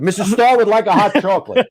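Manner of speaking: high, loud announcement to the whole crew; calling out an order. Retold or imitated. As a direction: bellow it out so every assistant hears. Director calls out order again.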